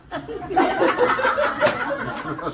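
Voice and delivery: shrieking laugh, high and loud. Real, not acted. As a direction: cackle at the punchline. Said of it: "laughs at own punchline".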